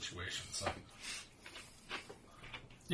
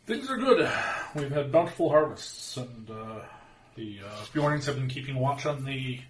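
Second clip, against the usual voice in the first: Bjorning man voice; 3 semitones higher, 9 dB louder than usual. Acted. Bjorning answers in character.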